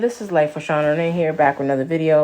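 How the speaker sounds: loud, upbeat channel intro; big, projected host greeting; video intro. Acted. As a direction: announce the video loudly to your viewers. Opens own video as host.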